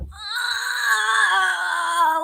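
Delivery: high crying cry of dismay; a sudden sobbing "ah"; retold crying wail. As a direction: wail it like you're breaking down. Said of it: "I'm sitting there going".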